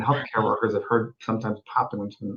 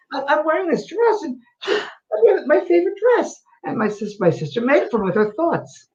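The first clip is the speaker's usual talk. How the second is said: Scottish woman; 14 semitones higher than usual. Retold or imitated. Medium retelling a Scottish spirit, high Scottish lady voice. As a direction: play the old Scottish woman, bemused. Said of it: "it was a Scottish woman".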